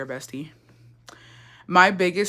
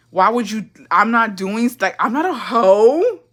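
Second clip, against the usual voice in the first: higher, drawn-out protest; hammed-up innocence, stretching the end; mock offended protest; 4 semitones higher, 4 dB louder, slower than usual. Daughter protests her dad's suspicion, mock offended.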